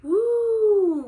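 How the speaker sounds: long high rising wind howl; howling wind woo. A narrator's reading. Narrator howling like the wind outside. Wind noise in bedtime story.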